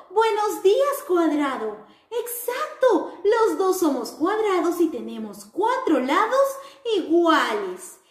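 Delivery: put-on sing-song greeting for children; cheerful square character. Mirror answers the square.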